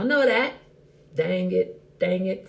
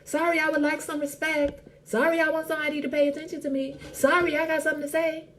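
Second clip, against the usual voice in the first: higher, meek mock sorries; timid apologizer; 8 semitones higher than usual. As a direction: parody someone sorry for existing. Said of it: "first thing we say is".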